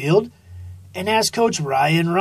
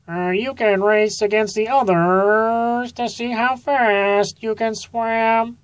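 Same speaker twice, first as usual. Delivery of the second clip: a lightly raised frog coach voice; a somewhat higher coach; 5 semitones higher than usual. A narrator's reading. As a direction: frog coach setting up a race.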